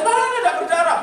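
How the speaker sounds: high, loud mock indignation; father's loud retort. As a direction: fire back as a disbelieving father. Acted father retorts to yelling son. (bantahan ayah yang lantang, ditirukan)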